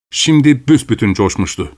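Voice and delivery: usual speaking voice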